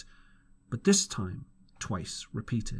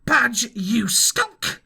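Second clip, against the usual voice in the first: very slow, loud, higher snarl; angry cry; 9 semitones higher, 7 dB louder, much slower than usual. A narrator's reading. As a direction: drag out the insult in fury. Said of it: "cried Pew".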